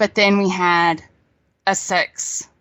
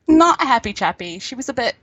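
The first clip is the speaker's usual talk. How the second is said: normal talking voice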